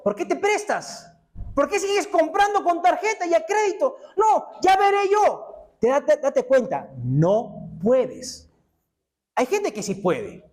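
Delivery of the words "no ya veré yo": much higher, louder, slow defiant yell; mock stubborn shout. Preacher mocks the debtor refusing advice, shouting high and slow. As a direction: shout it like a stubborn know-it-all.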